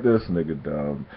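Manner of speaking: dismissive mumble; muttered aside. Retold or imitated. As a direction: mutter it to yourself, not for him to hear. Muttered private thought about stepfather.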